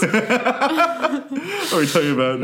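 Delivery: high, slow, through laughter; real laugh breaking up the words. Real, not acted. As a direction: squeeze the question out while laughing. Laughs at the Columbia joke.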